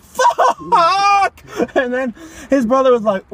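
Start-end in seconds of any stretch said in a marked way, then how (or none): 0.0-1.4 long, high, loud drawn-out curse; exaggerated howl of despair; anguished mock yell. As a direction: stretch the swear into a wail. Uncle's second bereaved outburst.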